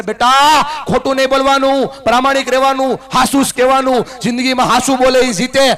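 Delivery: loud, high lecturing dad; father laying down the rules to his son; mock-stern father giving a moral lesson; preachy father. Father lecturing child, voiced in speech.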